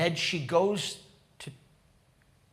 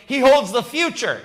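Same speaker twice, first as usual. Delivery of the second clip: loud, raised-pitch proclamation; full-force preaching; 7 semitones higher, 7 dB louder than usual. Acted. Preacher leading the congregation through hope in the risen Lord, proclaiming. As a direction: declaim it boldly, leaving room after phrases.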